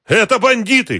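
very high, loud cry; frightened shout. A narrator's reading. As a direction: shout a desperate warning. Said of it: "крикнул Муравьёв, побелев от страха". (испуганный крик, очень высоко)

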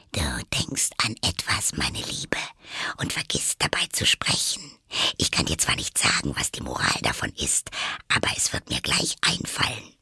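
soft whisper right at the ear; whispering in her ear. Acted. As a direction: lean in and whisper close. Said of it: "deren Stimme dicht an ihrem Ohr". (dicht am Ohr flüsternd)

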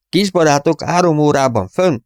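high, loud shout to the crew; shouted call. A narrator's reading. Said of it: "rikkantotta Verge". (magas, hangos kiáltás)

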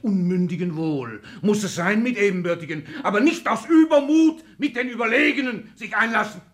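rising tirade. Acted. Father vowing to crush the daughter, in a rising tirade. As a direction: let the anger swell to the end. Father rants about his rival.

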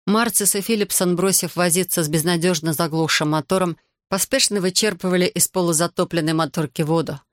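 ordinary delivery, nothing marked

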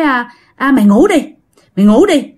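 retold cussing, lightly acted; scolding uncle. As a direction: imitate an uncle ordering a child to sleep. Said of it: "Còn bác hai chửi".

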